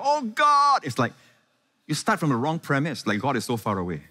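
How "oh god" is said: high, loud imitation of yelling to God; hammed-up yell heavenward; mock yelled prayer. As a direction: cry out as if God were far away. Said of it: "some people just yell by themselves, they yell".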